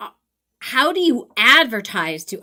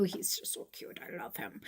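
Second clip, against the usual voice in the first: hushed, gushing aside; barely voiced adoration; adoring whisper; 16 dB quieter than usual. Real, not acted. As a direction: whisper it like a fond secret. Gushing over a favourite little student.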